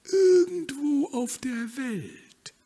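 high, slow and drawn out like a dreamy hen; mysterious hen. Acted. Hen tempts the duck to travel, high and slow. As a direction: lure the duck in with a dreamy far-off call.

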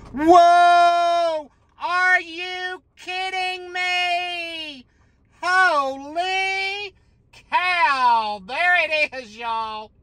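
very high, drawn-out whoops of real amazement; thrilled shouting. Real, not acted. Player finding the Jumbo symbol on his ticket, thrilled shouting. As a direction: holler it high and long, astonished. Hits the big symbol he wanted.

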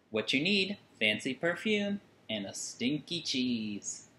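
usual speaking voice

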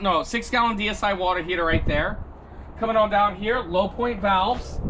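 ordinary talk, nothing marked

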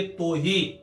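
ਤੋਹੀ said with o, tohee; ਤੋਹੀ with the hora vowel o; reading with hora. Shown as a demonstration. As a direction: say ਤੋਹੀ as to-hee. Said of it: "ਹੋੜੇ ਦੇ ਨਾਲ ਉਚਾਰਨ ਕਰਾਂਗੇ".

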